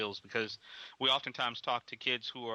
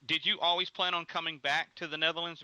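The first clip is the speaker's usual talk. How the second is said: usual speaking voice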